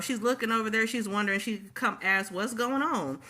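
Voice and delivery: normal talking voice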